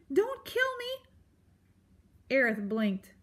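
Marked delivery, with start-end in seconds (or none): 0.1-1.1 very high mouse plea; frightened cry. A narrator's reading. Terrified mouse begging the porcupine, crying out. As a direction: beg for life in a squeaky high voice. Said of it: "Poppy cried through tra-, chattering teeth".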